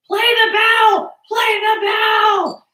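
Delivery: high, loud, slow mock chant of play the bell; imitated yelling. Max yelling at the teacher through the computer, high mock shout. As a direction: shout it like an impatient kid at a screen. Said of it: "Max was screaming at me through the computer going".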